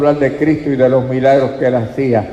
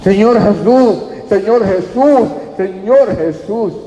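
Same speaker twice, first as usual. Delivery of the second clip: high, repeated pleading calls; shouting out; 7 semitones higher, slower than usual. Retold or imitated. Blind man calling for Jesus to notice him, shouting. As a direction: cry out high, stretching each repeat slower. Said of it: "Y empezó a gritar".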